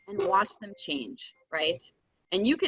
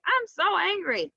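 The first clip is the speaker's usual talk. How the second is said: higher, lightly acted cross child; gentle pretend anger voiced as a child; mock angry kid; 10 semitones higher than usual. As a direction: play an upset kid, not too hard. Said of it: "So you could be like".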